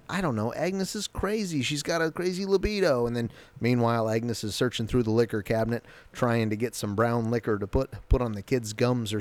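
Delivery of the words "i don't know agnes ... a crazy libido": higher, brushing it off in a put-on voice; a lightly voiced, higher Wanda; dismissive Wanda. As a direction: wave the question away, mock breezy. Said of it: "And Wanda's like".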